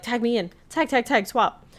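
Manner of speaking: high urgent tagging in; hurry, eager to swap; eager excited. Retold or imitated. Candy eager.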